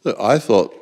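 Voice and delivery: ordinary delivery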